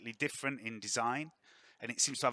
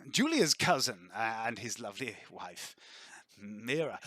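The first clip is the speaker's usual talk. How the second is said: lord's voice; 4 dB louder, slower than usual. Acted. Lord introducing his nephew and wife, grandly. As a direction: announce the guests grandly. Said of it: "Lord Refian Verence brings you in and goes".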